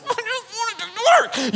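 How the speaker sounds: very high, strained, near-tears whine; frustrated crying. Retold or imitated. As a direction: whimper it like rage tears. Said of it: "rage tears coming on".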